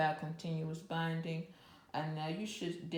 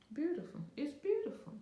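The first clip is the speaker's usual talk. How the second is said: delighted gush; 10 semitones higher, faster than usual. Real, not acted. Admiring her own stitches.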